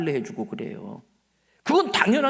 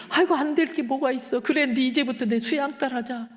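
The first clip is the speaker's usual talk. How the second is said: put-on laughing, pitched-up elderly lady's voice; delighted old deaconess; 7 semitones higher than usual. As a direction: play the tickled old church lady, chuckling. Deaconess warmly accepts new daughter.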